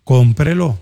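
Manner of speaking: ordinary talk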